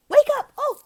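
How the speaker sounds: loud, very high squeal; startled wake-up call. Shown as a demonstration. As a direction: burst out of the hush, high and sudden. Acted waking of sleeping panda.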